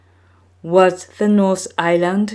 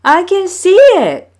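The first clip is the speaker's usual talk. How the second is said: much higher, loud, thrilled shout; excited cry; 13 semitones higher, 8 dB louder than usual. A narrator's reading. Maui spotting the giant fish, excited cry. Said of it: "Maui cried".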